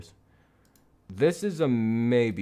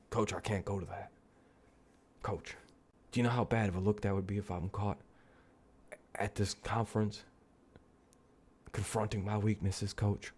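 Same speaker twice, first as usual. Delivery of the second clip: softer, hushed mock Deshaun plea; sheepish mock plea; 11 dB quieter than usual. Deshaun pleading with his coach, softer and sheepish.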